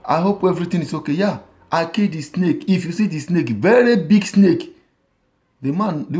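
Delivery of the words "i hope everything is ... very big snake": wife and husband talking. Retold or imitated. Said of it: "the wife come ask her".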